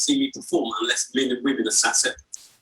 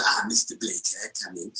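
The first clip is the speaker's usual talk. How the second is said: put-on low, hushed Yiddish-accented rabbi; slow, whispery welcome from an old-world rabbi; rabbi character; 9 semitones lower, 8 dB quieter than usual. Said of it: "he's quite a character".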